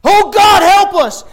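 very high, loud cry for help; panicked scream. Acted. Disciples freaking out in the storm, panicked scream. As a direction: scream as if the ship is going down. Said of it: "they're freaking out".

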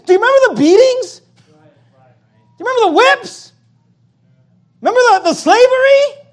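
God reminding them sharply of the whips; God answering back. Retold or imitated. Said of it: "God ... saying".

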